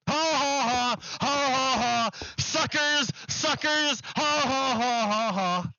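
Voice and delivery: mock evil laugh of a double-crosser; gloating villain laugh. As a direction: taunt them like a cartoon villain who just won. Manchin gloats after the vote.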